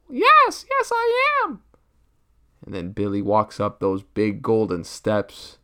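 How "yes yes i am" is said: put-on squeaky excited child, very high and loud; little kid voice. Three-year-old Billy thrilled about new family, squeaky high. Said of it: "Billy answers butler".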